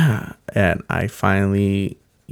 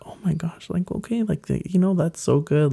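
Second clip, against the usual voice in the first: excited friends; 11 semitones higher than usual. Retold or imitated. Friends react to coming out.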